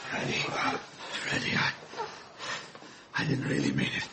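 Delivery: hushed, grieving whisper; soft, devastated murmur; broken whisper. Acted. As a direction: whisper his name through grief. Grieving over dying man.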